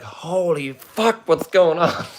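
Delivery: jolted awake and terrified by the sirens; panicked shock. Retold or imitated. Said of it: "I'm like".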